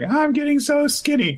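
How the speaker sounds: put-on very high complaining kid whine; child frets about getting thin, very high; whiny child. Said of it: "she's like".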